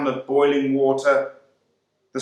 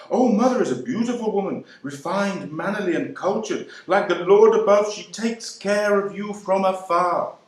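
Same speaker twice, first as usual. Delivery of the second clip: higher, quicker gushing mother's voice; doting mummy; 7 semitones higher than usual. Retold or imitated. Mummy praising Mother to her child, high and gushing. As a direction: gush about Mother like a proud mummy. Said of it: "Your own mummy talks of Mother".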